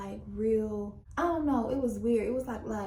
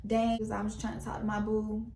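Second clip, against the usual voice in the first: ordinary talk, nothing marked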